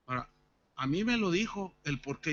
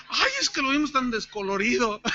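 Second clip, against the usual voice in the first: laughing quote; 7 semitones higher, 10 dB louder than usual. Retold or imitated. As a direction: talk through laughter, loud. Quoting staff while laughing.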